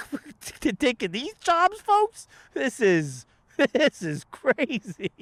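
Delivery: very high, slow, stretched-out disbelief; mock incredulous squeal. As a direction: squeal it like a mock-shocked onlooker. Mock incredulous rant to crowd.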